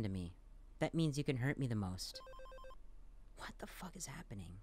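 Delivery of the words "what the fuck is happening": bewildered breathless whisper under the breath. Real, not acted. Player whispering at a baffling game scene. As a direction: hush it out like you can't believe it.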